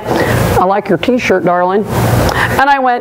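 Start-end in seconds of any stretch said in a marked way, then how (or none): 0.0-1.8 lightly voiced Johnny Depp, low and slow; low slow purr of a charmer; low slow Johnny Depp drawl. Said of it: "and he went".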